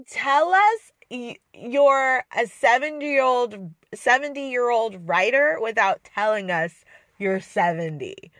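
ordinary talk, nothing marked

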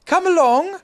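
called. A narrator's reading. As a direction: call up to the tree. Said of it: "called Christopher Robin".

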